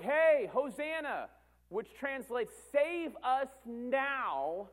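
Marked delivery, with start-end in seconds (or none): 0.0-1.3 high, drawn-out acclaiming shout; crowd crying out. Acted. Crowd hailing the king with Hosanna, loud drawn-out call. 2.7-4.7 high, long-stretched cry for rescue; acted crowd plea. Crowd begging the king for deliverance, stretched-out cry.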